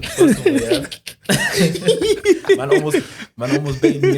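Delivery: high, loud laughing protest; laughing hard. Real, not acted. Caught out, laughing.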